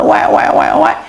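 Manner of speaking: breathy mock baby wail; baby cry imitation. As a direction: wek wek like a newborn crying. (tiruan tangis bayi)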